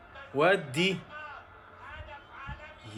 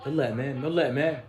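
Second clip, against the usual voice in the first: normal talking voice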